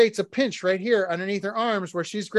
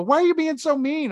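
higher, hurt wife voice; imitating the wife; 9 semitones higher, 3 dB louder than usual. Husband mimics his wife asking why he's mean, in a high hurt voice. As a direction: play the wife, wounded and whiny. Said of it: "And she'd go".

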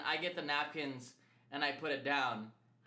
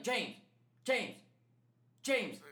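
higher, separated calls for attention; calling out; 7 semitones higher, slower than usual. Retold or imitated. Coworker trying to get the oblivious guard's attention, calling out. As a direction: call the name, waiting between each.

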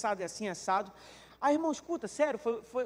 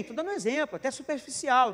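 ordinary talk, nothing marked

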